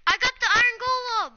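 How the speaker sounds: loud high triumphant shout; excited shout. Real, not acted. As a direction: yell out the win. Triumph after escape.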